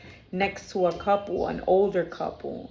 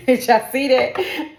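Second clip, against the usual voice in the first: loud, higher, slow question through laughter; laughing amazement; 6 semitones higher, 9 dB louder, slower than usual. Real, not acted. Reader asking viewers about what just happened, laughing amazement. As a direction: break up laughing as you ask viewers. Startled laugh at something seen.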